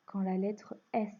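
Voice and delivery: ordinary talk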